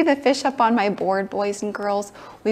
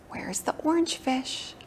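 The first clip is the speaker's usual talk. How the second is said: ordinary talk, nothing marked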